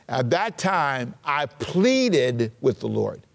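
drawn out at half pace; slow reading. Shown as a demonstration. Preacher reads the verse to the congregation, slowly. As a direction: read it slowly, stretching the words. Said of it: "I'm gonna read it slow".

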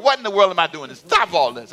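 usual speaking voice

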